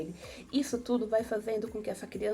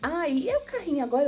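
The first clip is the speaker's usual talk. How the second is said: put-on excited mother to child; 6 semitones higher, 5 dB louder than usual. Shown as a demonstration. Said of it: "você sempre dá um tempo pra ela responder".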